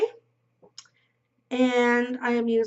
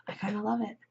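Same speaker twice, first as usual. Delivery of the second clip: whisper; 7 dB quieter, faster than usual. Real, not acted. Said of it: "whispered aside".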